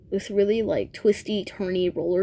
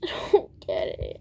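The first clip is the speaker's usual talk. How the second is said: words dissolving into voiceless laughter; helpless laughing. Real, not acted. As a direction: laugh too hard to voice it. Breaks up laughing, then cuts recording.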